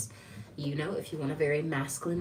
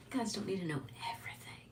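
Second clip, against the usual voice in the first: quick secretive whisper; playful rushed whisper, much softer; 9 dB quieter, much faster than usual. Real, not acted. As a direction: hush it fast to the viewers. Backs off from juicy stuff.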